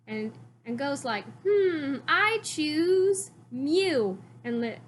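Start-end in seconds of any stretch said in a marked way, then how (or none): 1.5-4.2 higher, very slow dramatic declaration; dramatic choice. Retold or imitated. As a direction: draw it out like a grand decision. Go choosing his Pokemon.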